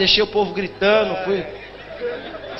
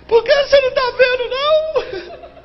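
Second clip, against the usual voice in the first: very high, loud mock shriek; hammed-up tearful screech; crying and screaming; 4 dB louder, slower than usual. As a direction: imitate a hysterical, wailing woman. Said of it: "Ela tava chorando e gritando".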